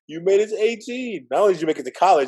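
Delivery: high, bright cheer of congratulation; excited cheer. Retold or imitated. Friends celebrating his eighteenth, cheering excitedly. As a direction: cheer it like a toast among friends.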